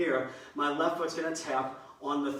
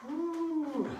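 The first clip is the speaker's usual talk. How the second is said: high, airy exhaled "whew"; relieved whew; 13 semitones higher than usual. Real, not acted. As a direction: blow out a high breath after exertion. Catching breath after the drill.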